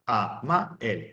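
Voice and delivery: "a, ma, el" as three separate syllables. Shown as a demonstration. Teacher counting three syllables for the class, syllable by syllable. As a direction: split "a, ma, el" with a break after each. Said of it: "Entonces dirías tres sílabas".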